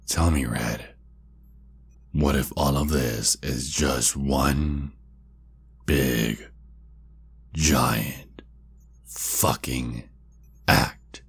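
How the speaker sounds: word by word, long pauses between; slow taunting. Acted. Vampire taunting Red that it was staged, slow and deliberate. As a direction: space out "big, giant, fucking act" for menace.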